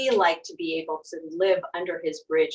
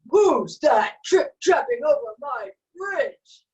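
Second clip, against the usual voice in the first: loud, slower troll bellow; troll shout; 7 semitones higher, 7 dB louder, slower than usual. A narrator's reading. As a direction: roar the challenge like a troll. Troll challenges big goat.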